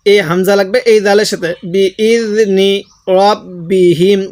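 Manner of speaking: ordinary talk, nothing marked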